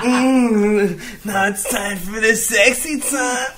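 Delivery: villain voice. Pretend. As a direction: purr it like a cartoon villain. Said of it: "Why you sound like a villain".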